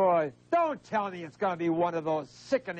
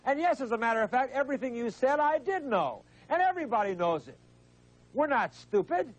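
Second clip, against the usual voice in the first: high-pitched exasperated outburst; offended host talking over a caller; indignant interruption; 7 semitones higher than usual. Real, not acted. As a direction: cut in, pitch jumping up in indignation. Host indignantly cuts off reciting caller.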